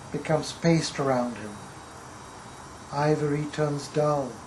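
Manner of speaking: measured recital, phrase by phrase with long pauses; poetry reading. Acted. Poet reciting to an audience about skulls of a lost tribe, measured poetry reading. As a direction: recite it gravely, weighting single words and letting lines fall. Reads second poem aloud.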